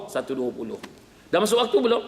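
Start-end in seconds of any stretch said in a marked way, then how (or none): 1.3-2.0 higher pitched mock-innocent asking; pressing question, pitched up; questioner. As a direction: play the persistent asker. Acted question-and-answer on prayer time.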